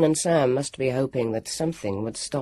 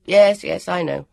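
a touch of lordly dismissal, louder; 4 semitones higher, 7 dB louder than usual. A narrator's reading. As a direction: brush it off like a little lord. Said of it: "in his most lordly manner".

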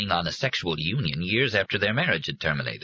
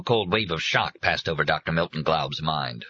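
ordinary delivery, nothing marked

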